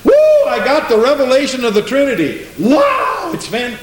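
high, whooping mock revelation cry; exaggerated rapture with high whoops; mock excited convert. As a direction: play an overjoyed believer, hammed-up. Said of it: "never yet heard a Trinitarian say".

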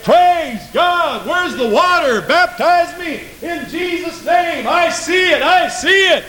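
high-pitched camp-meeting cries of revelation; acted rapture, very high and ringing; excited convert whooping. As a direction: cry out as if jumping for joy. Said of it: "jump up and down and run through the camp meeting".

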